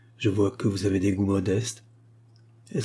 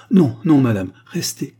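loud burst on the first words, easing off; urgent cry; 4 semitones higher, 8 dB louder than usual. A narrator's reading. Matchmaker begs the departing client to stay, crying out. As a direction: cry out to stop someone going. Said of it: "il s'écria, un peu haletant".

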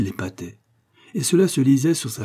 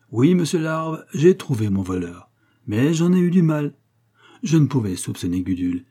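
higher, emphatic self-important lecturing; pompous amateur detective; 5 semitones higher than usual. Acted. Coquille begins his account to Larve, pompous and pleased. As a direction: hold forth like a proud sleuth.

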